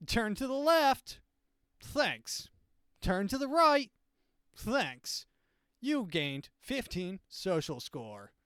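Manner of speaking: high-pitched, slow, chirpy machine instructions; cheerful robot reading out commands, rising high; mock-friendly police robot scanning a face. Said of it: "a really friendly robot who comes up to you".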